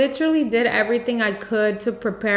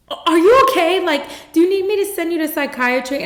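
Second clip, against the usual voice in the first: high, loud, hurried worried question; retold alarm; 8 semitones higher, 4 dB louder, faster than usual. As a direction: imitate an alarmed doctor checking on a sobbing patient. Imitating alarmed doctor at her sobbing.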